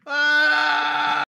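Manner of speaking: high, loud, long crying shout; mock wail. Co-host hearing the wife's insult, long high mock wail. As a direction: wail it out as if mortally hurt.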